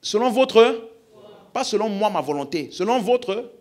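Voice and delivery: loud and high, driving each phrase home; preaching shout. Real, not acted. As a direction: shout it out to the whole church. Plus fort?.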